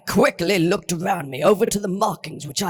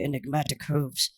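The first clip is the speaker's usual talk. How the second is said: ordinary delivery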